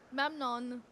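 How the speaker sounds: "Memnon" said as MEM-non, stress first; careful pronunciation. Shown as a demonstration. Correcting a friend on how to say "Memnon". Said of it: "sounds like a better way of pronouncing it".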